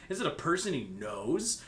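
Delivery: ordinary talk, nothing marked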